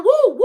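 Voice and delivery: high, loud whoop; high cheering woos; celebratory woo-woo. Real, not acted. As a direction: whoop in two bursts. Cheering thanks to a viewer.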